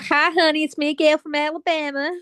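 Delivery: mock Alabama accent with a Scouse lilt; a lightly hammed Southern US greeting that drifts into Liverpool; Gail's Alabama accent. Retrying the character voice, she greets friends in mock Alabama. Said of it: "You did go very scouse there".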